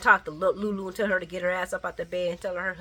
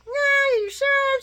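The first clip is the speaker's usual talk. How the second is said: doubtful Ava; 7 dB louder, much slower than usual. Retold or imitated. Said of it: "She's like".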